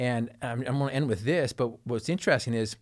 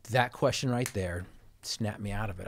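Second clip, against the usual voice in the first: usual speaking voice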